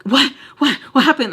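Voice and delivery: loud, high, alarmed questioning; hammed-up panicked bewilderment; startled. Girl scared by his gasp, startled and loud.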